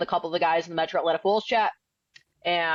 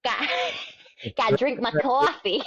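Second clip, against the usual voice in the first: put-on higher Boston accent, dropped R; 4 semitones higher than usual. Clowning for the host in a Boston accent. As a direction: gotta drink my coffee like a Boston local.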